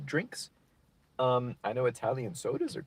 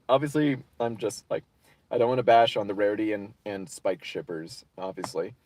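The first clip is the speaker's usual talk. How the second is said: ordinary delivery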